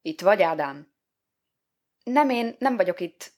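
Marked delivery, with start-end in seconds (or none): 2.0-3.3 high-pitched, hurried denial; high, caught-out excuse; hasty answer. A narrator's reading. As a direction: blurt it out in a high voice. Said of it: "feleltem gyorsan".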